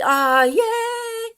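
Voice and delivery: high, loud happy cheer; excited cheer. Real, not acted. Relieved that she is heard.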